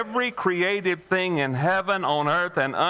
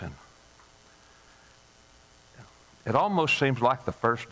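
closing prayer; 2 semitones lower than usual. Acted. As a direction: speak gently to the Lord.

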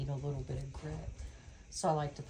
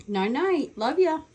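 high, louder cooing goodnight; 10 semitones higher, 8 dB louder, slower than usual. Real, not acted. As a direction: coo goodnight to the bird. Bedtime goodbye to bird.

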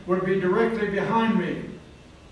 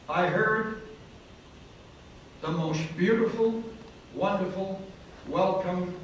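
ordinary delivery

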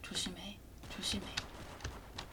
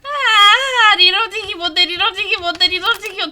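high, loud crying whine; panicked whine; 9 semitones higher, 15 dB louder than usual. Real, not acted. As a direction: whine in panic, close to tears. Panicked whining at reload. (울먹이며 외치는 비명)

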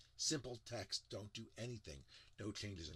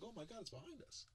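mock amazement; 10 semitones higher, 8 dB quieter than usual. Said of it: "You're like".

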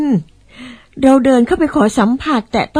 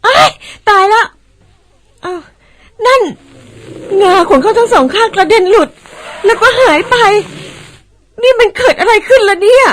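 high, loud panicked outburst; shocked cry; 9 semitones higher, 3 dB louder than usual. Acted. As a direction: shriek it in alarm. Tusks suddenly fall off. (ตกใจสุดขีด ร้องเสียงสูงดัง)

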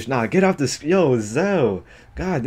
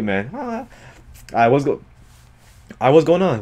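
ordinary delivery

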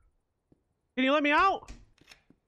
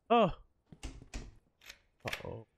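usual speaking voice

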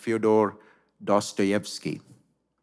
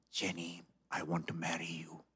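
hushed, breathy whisper, much softer; whispered proposal; 7 semitones lower, 16 dB quieter than usual. Acted. Saint-faced George proposes to Jenny.

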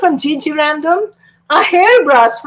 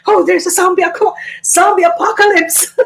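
high, fast fake alarm; mock panic; 6 semitones higher, faster than usual. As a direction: cry out a pretend zombie warning. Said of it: "And pretend like".

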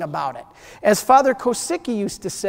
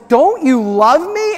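higher, louder, slow wounded question; a husband crushed by his wife's refusal; hurt plea; 5 semitones higher, 5 dB louder, slower than usual. Retold or imitated. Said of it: "right then he goes".

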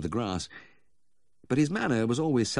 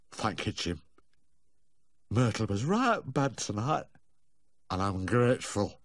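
Humphrey; 3 semitones higher than usual. A narrator's reading. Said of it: "his face became serious again".

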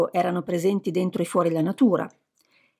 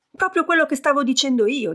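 higher, triumphant told-you-so; 7 semitones higher than usual. A narrator's reading. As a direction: crow over being right, lightly. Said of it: "con voce trionfante".

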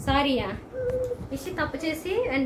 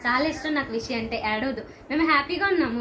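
ordinary delivery

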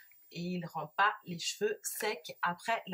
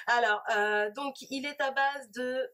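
usual speaking voice

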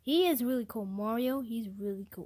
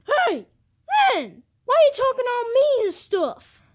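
loud, higher-pitched protest; indignant shout; 10 semitones higher, 8 dB louder than usual. Acted. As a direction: push back hard at him. Protesting friend's rude talk.

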